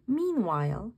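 ordinary delivery, nothing marked

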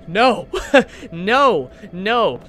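loud yelped "no" with a laugh; startled refusal. Real, not acted. Player spooked by horror game, loud startled refusals. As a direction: yelp it, loud and high, spooked.

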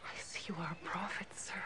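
hushed, breathless whisper. Acted. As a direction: breathe it out in awe. Woman awed before a prophet.

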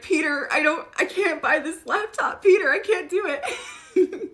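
high, slowed, pleading through laughter; laughing plea. Retold or imitated. Andre begging Peter at the checkout, laughing and high. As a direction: squeak it out between laughs. Andre at the counter, card declined.

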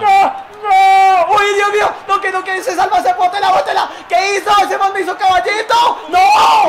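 loud, high cries of disbelief at the game; real gamer shouting over a blown play; real frustrated yelling. Real, not acted. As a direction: yell at the screen, losing it. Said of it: "estoy gritando mucho".

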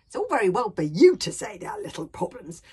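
put-on indignant young retort; cross. A narrator's reading. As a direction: snap back at grandfather, loudly. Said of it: "said Hiccup crossly".

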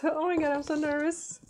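high, giggly burst of nerves; nervous giggle. Real, not acted. Vlogger about to open her friend's package, high nervous giggle. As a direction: squeak it out through nervous laughter.

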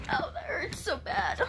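high whispered sob of pain; whispered cry. Real, not acted. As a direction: sob it under the breath. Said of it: "I'm crying right now".

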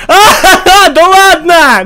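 high, slow incredulous laugh; laughing in disbelief. Real, not acted. Disbelieving laugh mid-game.

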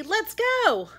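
high, loud and eager; excited cry. A narrator's reading. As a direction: call it out, raring to go. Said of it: "cried Froggy".

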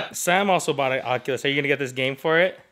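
ordinary talk, nothing marked

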